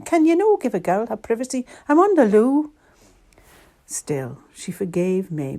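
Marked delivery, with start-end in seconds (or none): 0.0-2.7 loud, high-pitched complaint; exasperated, quick, then punched loud at the end; shouting. A narrator's reading. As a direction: shout for some privacy. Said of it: "she would shout every morning".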